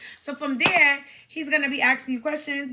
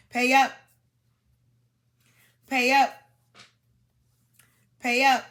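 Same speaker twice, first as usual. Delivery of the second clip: louder, punchy repeated chant; quoting JT; 6 dB louder than usual. Retold or imitated. Quoting JT's line to viewers, punchy and loud. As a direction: hit each pay up like a beat. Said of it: "What J T said".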